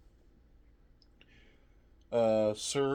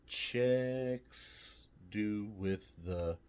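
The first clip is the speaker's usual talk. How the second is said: ordinary talk, nothing marked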